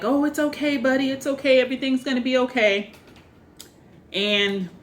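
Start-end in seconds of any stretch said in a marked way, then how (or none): 0.0-2.9 high, cooing reassurance; lightly voiced soothing doll; imitating soothing Andy. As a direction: comfort a child, half-playful. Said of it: "Andy's talking back to him like".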